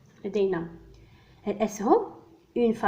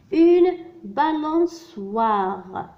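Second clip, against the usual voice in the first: balançoire drawn out, a bit louder; slow and emphatic; 4 semitones higher, 4 dB louder, much slower than usual. Shown as a demonstration. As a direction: dictate the word clearly. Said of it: "Je complète les mots avec wa".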